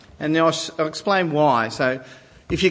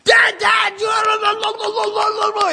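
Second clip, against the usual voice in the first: chattering son; much slower than usual. Retold or imitated. Twelve-year-old son bursting out to dad, high excited shouting. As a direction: gush out the news nonstop. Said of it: "my twelve-year-old just chats".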